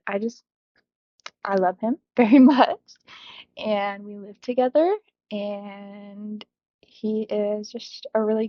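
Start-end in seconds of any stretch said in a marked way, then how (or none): none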